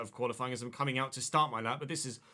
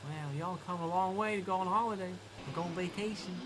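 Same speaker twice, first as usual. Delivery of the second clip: higher mock Southern US voice, amused; Southern American accent; 3 semitones higher, 4 dB quieter than usual. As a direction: ham up a friendly Tennessee local. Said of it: "When we went to Tennessee on holiday".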